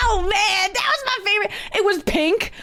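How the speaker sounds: high-pitched comic whine; mock lament. Caller losing her favorite bottle, mock lament. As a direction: wail playfully over a lost treasure.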